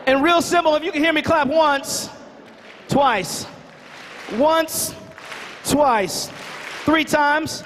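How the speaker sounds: high, projected attention call. Real, not acted. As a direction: call a chattering crowd back to order. Calls noisy audience back to attention.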